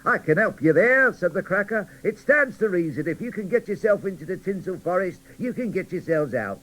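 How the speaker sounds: jolly show-off telling mottos; cheerful cracker cheering up gloomy dolls. Acted.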